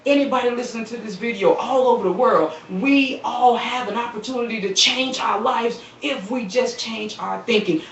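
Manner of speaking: ordinary talk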